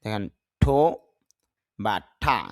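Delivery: ordinary talk, nothing marked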